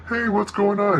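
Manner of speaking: high, bright mock "hey what's going on"; mock party greeting. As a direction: ham up a friendly party hello. Party guests greeting newcomer.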